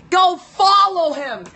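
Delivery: ordinary delivery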